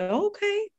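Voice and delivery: high, squeaky put-on okay; mock okay. Retelling a fiery exchange, a high mock okay. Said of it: "I was like".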